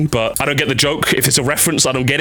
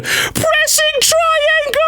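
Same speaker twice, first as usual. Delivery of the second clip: high, slow shouted battle call; mock voice line; much slower than usual. Console player inventing her line, high slow shouted callout. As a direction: belt it like a hero callout. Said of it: "I'd like it if she said".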